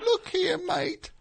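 tiny, very high English voice; Peter Grant impression. Mini band manager addressing a mate, squeaky-high and soft. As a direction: pitch it way up, small and soft. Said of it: "his voice was way higher because he was so small".

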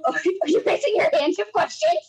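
high, laughing question. Real, not acted. Guest spots host raising a hand, asks laughing. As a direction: break into laughter while asking. Amused at cohost's raised hand.

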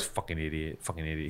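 low, hushed grumbled curse; muttered to himself. Retold or imitated. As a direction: imitate someone cursing under their breath. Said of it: "to himself about me".

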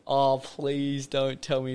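slow, loud mock pleading; retold dread. Replaying reaction to mate's opportunity, slow mock dread. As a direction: drag it out in exaggerated dread.

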